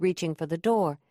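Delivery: ordinary delivery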